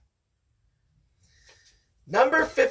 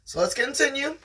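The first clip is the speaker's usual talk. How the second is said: ordinary talk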